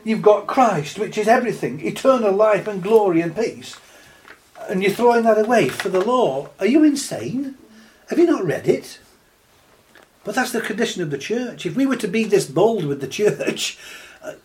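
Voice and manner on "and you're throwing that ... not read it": incredulous rebuke. Real, not acted.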